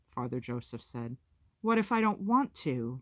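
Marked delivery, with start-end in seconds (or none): 1.6-3.0 high, loud, defiant challenge; angry demand. Acted. Dicey defying the adults about her mother, angry and loud. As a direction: throw the question back at them in anger. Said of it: "Dicey demanded, angry".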